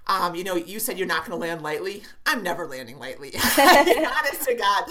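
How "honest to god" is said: laughing. Real, not acted. Laughs at own loud landing.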